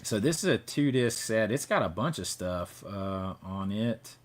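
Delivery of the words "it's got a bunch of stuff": usual speaking voice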